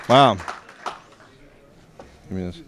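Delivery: ordinary talk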